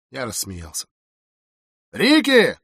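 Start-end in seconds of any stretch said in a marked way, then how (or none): 1.9-2.6 loud, high call from upstairs; calling out loudly. A narrator's reading. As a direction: call the name out to someone below. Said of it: "громко позвал Сайрос". (громкий оклик)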